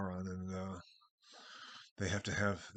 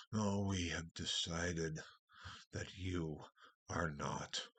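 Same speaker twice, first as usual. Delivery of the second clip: slow, drawn-out, lower Treebeard; 4 semitones lower, 4 dB quieter, much slower than usual. Retold or imitated. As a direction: stretch every word like an ancient tree. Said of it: "the Ents talk very slowly".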